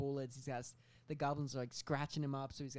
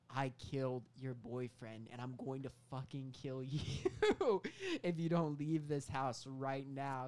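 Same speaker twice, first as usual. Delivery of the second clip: slow threat; slower than usual. Retold or imitated.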